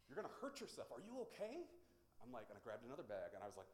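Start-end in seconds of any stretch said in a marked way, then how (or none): 0.1-1.7 high-pitched mock alarm; alarmed concern. Villagers fearing he will hurt himself, alarmed concern. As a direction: voice worried onlookers, pitch rising sharply. Imitates alarmed onlookers after gasp.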